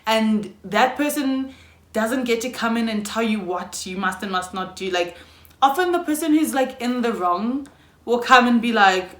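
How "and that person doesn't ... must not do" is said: ordinary talk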